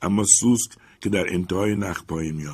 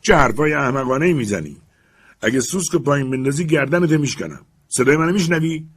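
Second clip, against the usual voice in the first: higher, louder furious threat; angry shout; 6 semitones higher, 5 dB louder than usual. Acted. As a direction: bark the threat up at him. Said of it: "لوگران فریاد زد".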